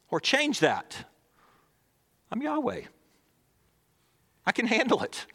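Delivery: lightly voiced God, gently sure of himself; a hint of God calming a worried child; God speaking. Preacher voices God to listener.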